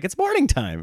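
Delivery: put-on high little-kid voice, insistent; a hint of a toddler announcing morning; imitating toddler. Toddler telling dad it's morning. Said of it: "And he's like".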